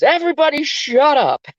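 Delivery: high, loud mock shout; shouted shut up. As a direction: bark the order at the whole room. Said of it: "Finally, Tiega says".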